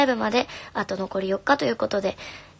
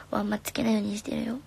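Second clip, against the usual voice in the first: soft breathy aside; hushed whisper; 6 dB quieter than usual. Real, not acted. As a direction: drop to a whisper mid-sentence. Aside about earplugs during rehearsal.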